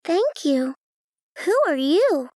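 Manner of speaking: shy, high little voice; bashful. Acted. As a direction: answer shyly, a bit embarrassed. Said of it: "she replied bashfully".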